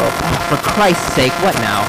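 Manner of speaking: loud, slow exasperated outburst; fed up and snapping. Acted. Annoyed at child.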